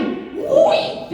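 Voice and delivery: comic high-pitched outburst; mock yell. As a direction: yelp it way up high, gleefully silly. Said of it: "And I yell".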